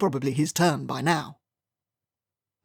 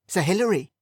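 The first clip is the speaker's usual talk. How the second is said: higher, insistent call; calling him awake; 4 semitones higher than usual. A narrator's reading. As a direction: call his name to wake him. Valet calling, dream fading.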